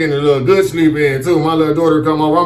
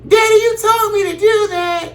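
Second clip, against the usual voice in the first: put-on high, tattling child; little girl voice. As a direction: tell on dad like a small kid. Daughter blames dad.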